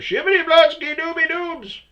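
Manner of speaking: very high, loud silly babble; hammed-up nonsense voice; mock gibberish. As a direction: parody incomprehensible mumbling. Mocking coworker's mumbled chatter.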